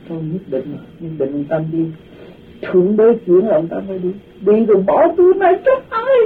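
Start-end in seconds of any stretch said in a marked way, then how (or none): 4.4-6.2 high wailing cry, retold; imitating crying. Old man weeps as the monk leaves, high wailing cry. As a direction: wail it like a weeping old man. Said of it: "Khóc vậy đó".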